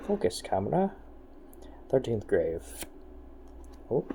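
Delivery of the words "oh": ordinary talk, nothing marked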